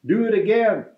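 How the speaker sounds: high, eager cheer; eager shout. Acted. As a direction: egg the dragon on, cheering high. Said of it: "but Elizabeth shouted".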